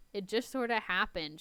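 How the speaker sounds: usual speaking voice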